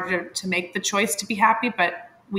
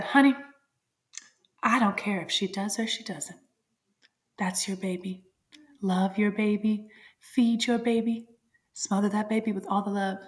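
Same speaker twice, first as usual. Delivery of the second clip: lovely nurse's comfort; 3 dB quieter than usual. Retold or imitated. Nurse reassuring the new mom about her baby, softly. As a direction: gently soothe a scared mother. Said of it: "She was just so lovely".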